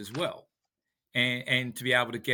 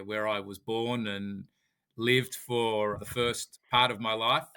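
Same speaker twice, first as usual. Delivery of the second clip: ordinary delivery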